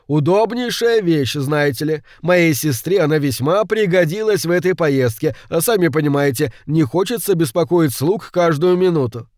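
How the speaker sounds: high, voluble explanation; man explaining himself. Acted. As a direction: justify yourself chattily, pitch lifted.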